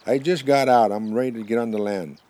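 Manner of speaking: loud, high-pitched answer; calling back. Acted. Buffalo answers the porcupine in his stomach, calling back. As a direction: holler the progress report, voice up high.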